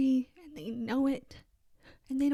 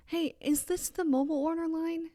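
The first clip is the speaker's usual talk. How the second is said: higher, gentle polite asking; sweet polite question; 5 semitones higher than usual. Retold or imitated. Asking the older couple about the mobile line, sweetly polite. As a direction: ask the stranger as nicely as you can. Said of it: "this is exactly how I said it too".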